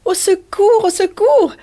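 high, loud mock cry for help. Wife smothered by her husband's kisses, high playful shout. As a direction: squeal out for help, half laughing. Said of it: "cria Riquette".

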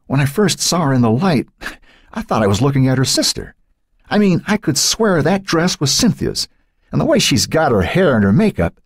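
high, bewildered father's voice; trailing off. A narrator's reading. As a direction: recount it in shaken disbelief, pitched high. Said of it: "His voice trailed off".

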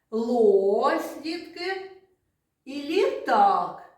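clear, loud demonstration words «Лось» and «літак»; sound demo. Shown as a demonstration. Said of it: "teacher demonstrates word sounds".

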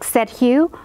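plain reading voice

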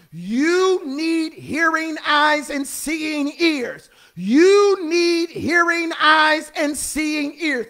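long, stretched-out preaching words; slow drawn-out emphasis. Real, not acted. Preacher charging the congregation with a refrain, slow and drawn-out. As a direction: draw each word out slowly.

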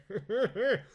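high hearty chuckle; short amused aristocratic laugh; genial laugh. Acted. Earl laughs.

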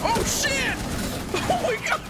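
high-pitched cry of alarm; real jump-scare panic; startled yell. Real, not acted. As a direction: yell out in shock. Startled by screaming enemy.

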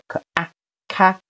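咔 lifted high as the stressed syllable; teacher demonstrating high stress on 咔. Shown as a demonstration. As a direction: sound out 可、啊, then pitch 咔 up. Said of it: "重音往上提".

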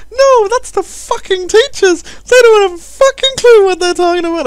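put-on loud, very high falsetto child; mock little girl ranting. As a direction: squeal it as an indignant daughter. Imagined daughter answers back, swearing.

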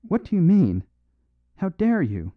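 high, loud panicked outburst; a cornered man lashing out in alarm; frantic cry. A narrator's reading. The prophet recoils from the priest, frantic and loud. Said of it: "he cried repeatedly".

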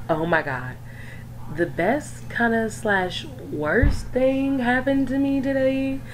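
normal talking voice

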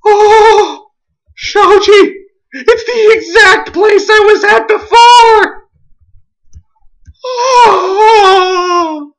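high, wailing squeal; overwhelmed excitement. Real, not acted. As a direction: cry out at a longed-for discovery. Overjoyed at finding old place.

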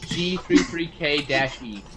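slowly spelling it out letter by letter; slow dictation. Shown as a demonstration. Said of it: "how do you spell that website".

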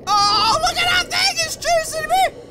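real alarm, high pitched; panicked. Real, not acted. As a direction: squeal it out as the thing chases you. Creature chasing him in game.

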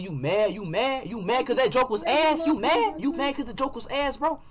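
needling, pitch climbing with each repeat; taunting. Real, not acted. Streamer roasting an opponent over a bad joke, taunting.

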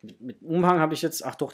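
ordinary delivery

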